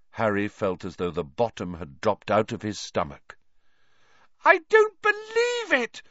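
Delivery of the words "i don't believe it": very high, loud outraged scream. A narrator's reading. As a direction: scream it in disbelief as a girl. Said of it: "Hermione screamed".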